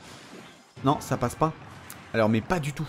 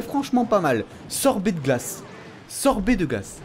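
ordinary delivery

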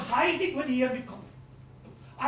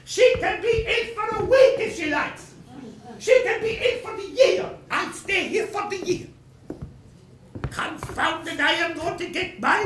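higher and louder, stubborn; defiant outburst; 6 semitones higher, 3 dB louder than usual. Acted. Creditor vowing to outlast the widow, defiant and higher. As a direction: dig in your heels loudly.

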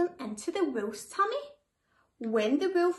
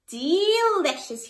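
slow, louder, relishing the taste; savouring; 4 semitones higher, 7 dB louder, slower than usual. A narrator's reading. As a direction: drag out the pleasure of the meal. Wolf savours his meal.

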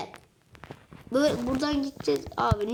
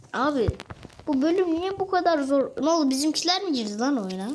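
ordinary talk

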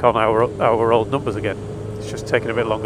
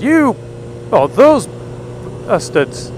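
mock outrage; 8 semitones higher, much slower than usual. Mock outrage at the cold caller.

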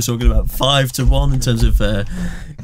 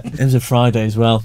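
normal talking voice

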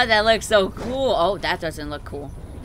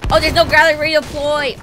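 surprised realization; 8 semitones higher, 8 dB louder than usual. Real, not acted. Discovers game mechanic.

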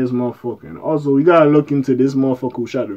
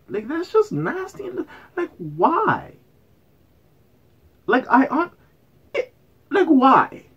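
appalled squeak; 13 semitones higher, slower than usual. Real, not acted. Disgusted by the video.

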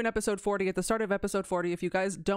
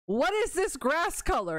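higher-pitched, indignant question; upset rant; 9 semitones higher than usual. Real, not acted. Streamer ranting to viewers about changed grass color, upset. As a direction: protest in a raised pitch. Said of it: "that's the little rant I have".

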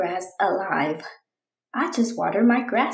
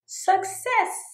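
much higher, bursting with pride; proud high exclamation; triumphant cry; 10 semitones higher than usual. Acted. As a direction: announce the win. Mission succeeded.

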